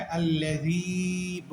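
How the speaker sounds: "আল্লাযী" with the final ii held long; one-alif elongation. Shown as a demonstration. Teacher showing students the stretch, "আল্লাযী" ending in long ii. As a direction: draw out the last vowel of "আল্লাযী" evenly. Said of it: "এক আলিফ টেনে বলবো".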